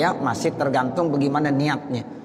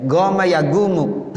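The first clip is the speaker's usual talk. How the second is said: slow Egyptian "goma ya gumu"; hard Egyptian g in "goma ya gumu"; Egyptian dialect; 4 semitones higher, 4 dB louder than usual. Shown as a demonstration. Teacher imitating Egyptians, Egyptian-dialect "goma ya gumu". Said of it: "Itu dialek orang Mesir".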